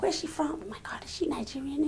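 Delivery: whispered, curious gossip from the seats; retold audience whisper. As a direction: whisper like a puzzled audience member.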